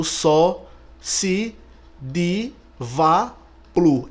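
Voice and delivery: each syllable of "só, se, di, va, plu" set apart by a pause; mnemonic spelled out. Shown as a demonstration. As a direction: dictate the memory aid piece by piece. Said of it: "Esse aqui é o bizu". ("só, se, di, va, plu" ditado pausadamente, sílaba por sílaba)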